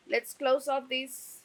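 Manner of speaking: normal talking voice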